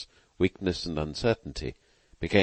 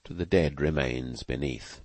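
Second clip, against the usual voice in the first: usual speaking voice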